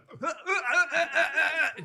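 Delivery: high, rapid repeated choking grunts; imitated gagging noises. Comic showing how people go at it, imitated gagging. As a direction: mimic rough gagging in bursts. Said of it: "people go like".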